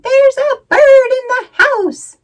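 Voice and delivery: panicked mother screaming at a bird. Acted. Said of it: "She screamed".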